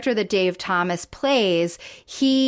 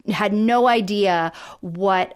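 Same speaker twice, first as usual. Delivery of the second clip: ordinary delivery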